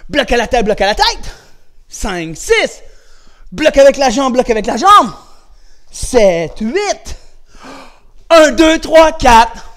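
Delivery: loud, high counted commands; drill calls. Shown as a demonstration. Coach calling blocking drill for kids to repeat, loud. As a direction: bark out each block for the kids to copy. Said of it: "répète après moi".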